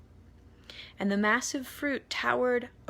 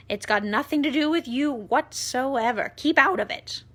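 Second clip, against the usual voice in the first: loud, higher, bossy voice; bossy aunt; 5 semitones higher, 8 dB louder than usual. A narrator's reading. Aunt Sponge ordering the boy off, loud and high. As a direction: shoo him away loudly. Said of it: "Aunt Sponge declared".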